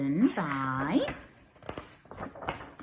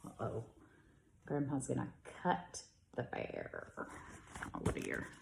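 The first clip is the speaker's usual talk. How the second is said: much softer, worried aside; hushed worry; 7 semitones lower, 16 dB quieter than usual. Real, not acted. Teacher fretting that Grandpa will cut the bear, hushed. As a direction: murmur it like suspense.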